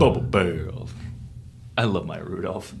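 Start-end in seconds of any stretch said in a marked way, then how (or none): 0.0-0.9 low, sultry cartoon voice; Connie impression. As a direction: purr it like Connie. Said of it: "Now say bubble bath like Connie".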